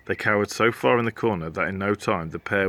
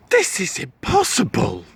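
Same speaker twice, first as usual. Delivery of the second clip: a touch of a despairing wail, higher; mild wail; 8 semitones higher than usual. Acted. The boy wailing at the castle wall. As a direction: whine it hopelessly. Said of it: "the boy wailed".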